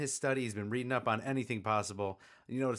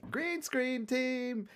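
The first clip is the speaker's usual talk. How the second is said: drawn-out; 12 semitones higher, much slower than usual. Pretend. Slow drawn-out joke line.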